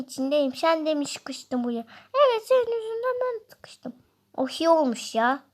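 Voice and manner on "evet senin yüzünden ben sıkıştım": high taunting voice; other character voice. Acted. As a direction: voice the rival, pitched high and teasing. Rival character answers.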